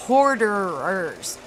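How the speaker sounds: "Hoarderers" slow, every syllable drawn out; over-enunciated. Shown as a demonstration. Streamer telling viewers which word she means, over-enunciated. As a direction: stretch out each syllable of "Hoarderers". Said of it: "Do I gotta spell it out?".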